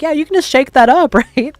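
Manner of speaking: high, chirpy mock-innocent ask, a laugh breaking in; mock naive customer. Customer asks to shake solid paint.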